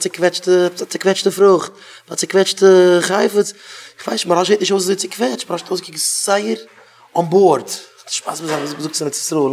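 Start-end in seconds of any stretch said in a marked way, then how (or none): none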